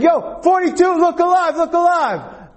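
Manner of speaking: very high, strained sideline holler; imitating an insistent coach; coach yelling out. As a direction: yell to snap a player awake. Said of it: "she yelled out".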